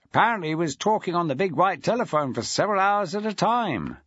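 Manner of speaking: put-on a deputy head greeting an old mate; Aaron Felton, jovial. A narrator's reading. Aaron Felton greeting Maxwell on the phone, jovial.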